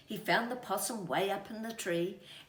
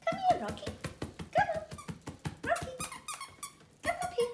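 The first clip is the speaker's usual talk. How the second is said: high, loud call to the dog; calling pet dog Rocky to come up; 5 dB louder, much faster than usual. Real, not acted. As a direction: coax the dog over sweetly.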